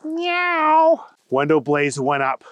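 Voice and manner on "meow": high mewing kitten voice; cat meow. Acted. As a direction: mew it like a small cat. Cat answers agent.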